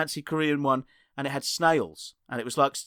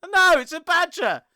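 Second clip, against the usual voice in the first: much higher, louder squeaky nonsense voice; silly squeaky comic; 11 semitones higher, 4 dB louder than usual. Pretend. Host doing a mock badger comic act, high and squeaky. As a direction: ham up a squeaky daft puppet voice.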